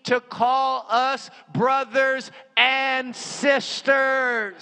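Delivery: ringing, emphatic pulpit delivery; full-force preaching. Real, not acted. Preacher reads scripture to the congregation, full-force preaching. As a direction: proclaim it with drawn-out emphasis.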